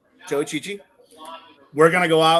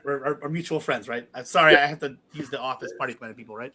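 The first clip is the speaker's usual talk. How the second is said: usual speaking voice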